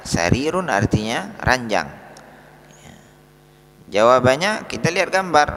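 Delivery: normal talking voice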